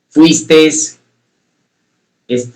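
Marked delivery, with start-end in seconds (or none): 0.1-1.0 "fuistes" said a bit louder, the final s clearly sounded; wrong form fuistes. Shown as a demonstration. Teacher showing students the wrong form "fuistes", louder. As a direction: stress the extra s in "fuistes" to show the error. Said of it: "no es la pronunciación correcta".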